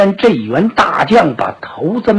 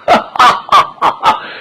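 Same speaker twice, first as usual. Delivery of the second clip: booming laugh; 5 semitones higher than usual. Acted. Zhang Fei mocks Ma Dai.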